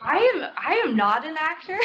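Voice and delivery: sheepish laughing answer; laughing denial. Real, not acted. Answering the audience question, laughing sheepishly.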